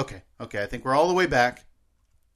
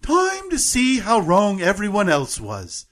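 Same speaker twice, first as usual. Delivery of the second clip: slow, high British announcer impression; measured, lifted British accent; 6 semitones higher, slower than usual. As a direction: put on a careful British voiceover, drawn out. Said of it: "put it all together".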